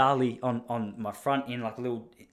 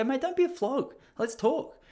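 much higher mock-tough "mate"; cocky retort; 12 semitones higher than usual. Squaring up to the stranger, high and cocky. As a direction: play it like a pumped-up bloke.

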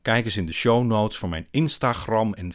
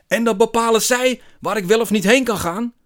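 very high, loud furious shout; foaming with rage; 10 dB louder than usual. A narrator's reading. Man answers narrator's mild suggestion, foaming with rage. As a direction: explode in full-voiced indignation. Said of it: "schuimt hij".

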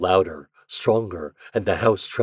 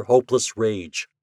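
usual speaking voice

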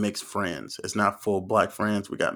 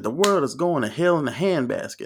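mock preacher, pitched up and swinging; 5 semitones higher than usual. Preacher cussing from the podium, mock preaching. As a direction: preach it out like a parody sermon. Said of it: "he was going in on that podium".

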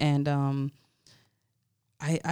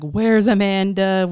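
loud, higher, drawn-out search call; retold panicked call; 4 semitones higher, 7 dB louder, slower than usual. Tour manager looking for missing performer, loud retold alarm. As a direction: stretch it out loud, imitating alarm.

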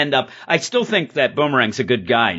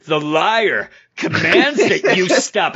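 hammed-up command, drawn out and higher; the Liar; 4 semitones higher, slower than usual. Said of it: "And then starts like".

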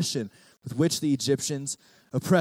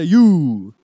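call and response; 2 semitones higher, 13 dB louder than usual. Shown as a demonstration. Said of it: "Everyone say".

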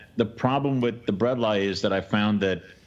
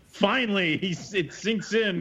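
sarcastic relief; 9 semitones higher, slower than usual. Retold or imitated. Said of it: "You're like".

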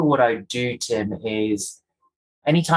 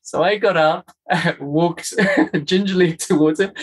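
laughing; 7 semitones higher than usual. Real, not acted. Laughing while retelling the moment.

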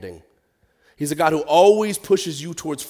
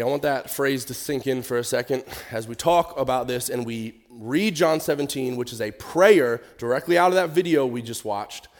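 normal talking voice

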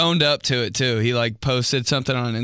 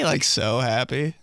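normal talking voice

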